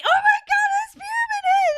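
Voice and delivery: very high, loud fangirl squeal; full-volume imitation of squealing fans; excited squeal. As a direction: shriek it like a thrilled fan spotting an idol. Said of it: "I heard girls squeal when they saw me".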